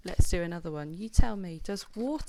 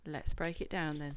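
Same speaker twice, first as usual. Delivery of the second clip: ordinary delivery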